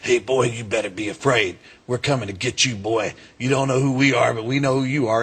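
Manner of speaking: mild mock-threatening phone caller; disguised voice. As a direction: retell a threatening call in a lightly disguised voice. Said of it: "disguised my voice".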